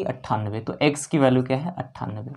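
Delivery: normal talking voice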